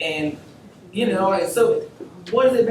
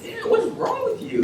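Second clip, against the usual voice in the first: laughing exasperation. Retold or imitated. Laughing at rude receptionist.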